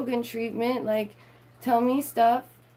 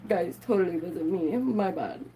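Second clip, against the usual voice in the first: mock Musk; 2 semitones lower than usual. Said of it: "'cause that's how he speaks".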